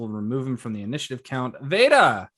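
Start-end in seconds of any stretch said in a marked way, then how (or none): 1.6-2.3 calling out. Real, not acted. GM calls the next player.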